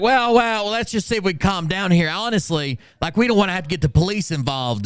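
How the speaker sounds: high, condescending mock of the manager; high, patronizing mock voice. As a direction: talk down like calming a child. Mocking the manager's warning.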